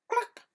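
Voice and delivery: high, sharp clucking; hen cluck. A narrator's reading. Storyteller clucking high as the hen. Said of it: "And the hen said".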